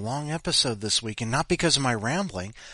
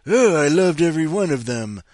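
gushing commenter; 5 semitones higher, 8 dB louder than usual. Retold or imitated. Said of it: "people said".

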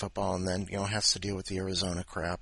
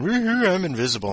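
loud, higher whoop of fake glee; mock gleeful cheer; 9 semitones higher, 7 dB louder than usual. Man with the ring who thinks he's invisible, mock gleeful whoop. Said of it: "thinking he turns invisible".

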